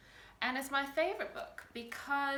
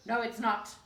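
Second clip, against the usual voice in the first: grumpy troll; 7 dB louder than usual. A narrator's reading. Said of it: "grumped the Grunt".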